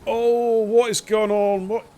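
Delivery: high, loud, drawn-out disbelief; shocked outcry. Real, not acted. Commentator reacts to a strange move on track, astonished cry. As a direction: cry out in real astonishment, stretching the words.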